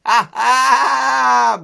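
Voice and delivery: loud, high mocking lamb laugh; a lamb jeering with a bleat. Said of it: "bleated a big lamb".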